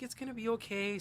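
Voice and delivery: high, soothing retold comfort; gentle reassurance. Boyfriend reassuring crying partner as he packs, gently. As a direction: reassure in a lifted, unforced voice.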